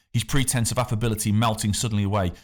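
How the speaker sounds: ordinary delivery, nothing marked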